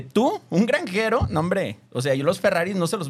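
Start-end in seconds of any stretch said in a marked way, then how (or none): 0.0-1.3 scornful Ferrari. Retold or imitated.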